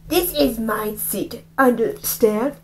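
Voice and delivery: slow, lightly menacing bully voice; slow bully threat. Acted. Bully warns Rafe about the seat, slow and menacing. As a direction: drag out the threat. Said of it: "He said".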